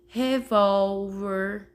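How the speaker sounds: "Revólver" stretched slowly, each syllable held; drawn-out syllables. Shown as a demonstration. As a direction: sound out "Revólver" slowly for counting. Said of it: "consigam contar as sílabas".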